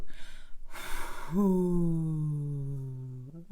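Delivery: slow, audible exhale from the diaphragm; long breath out. Shown as a demonstration. Coach demonstrates a diaphragm breathing exercise, long audible exhale. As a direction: let a long whispery breath out onto your hand. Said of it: "just try to breathe on your hand".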